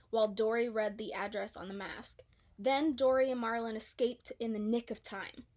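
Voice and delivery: ordinary delivery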